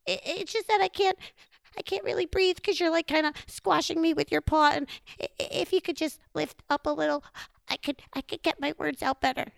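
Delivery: high, rushed, halting plea; squashed Chipmunk. Acted. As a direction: stammer quickly as if pinned under a paw.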